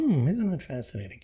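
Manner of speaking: a hint of cool fascination; Spock-like calm. Showing how to answer negativity, lightly calm and soft. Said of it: "and says".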